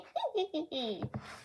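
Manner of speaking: high cackling villain laugh; Doflamingo laugh. Retold or imitated. Imitating Doflamingo mid-quote, high smug cackle. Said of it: "I have an amazing Doflamingo impression".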